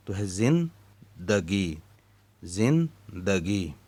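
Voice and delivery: ज़िंदगी drawn out slowly as zin-da-gi; careful pronunciation. Shown as a demonstration. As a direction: slowly sound out ज़िंदगी syllable by syllable. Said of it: "जे के नीचे ज़ेर और नून के ऊपर जज़्म". (ज़िंदगी धीरे-धीरे, साफ़ उच्चारण)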